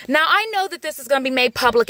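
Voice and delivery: ordinary talk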